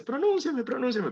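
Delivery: high-pitched little letter begging to be noticed; pleading letter voice. Acted. Teacher voices the silent U for students, high playful plea. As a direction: squeak it like a letter jumping for attention. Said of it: "está saltando y dice".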